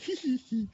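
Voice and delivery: put-on high-pitched teenage giggle; imitating a schoolgirl titter; mock girly giggle. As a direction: giggle like a thirteen-year-old girl. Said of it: "I just giggled like a thirteen-year-old girl".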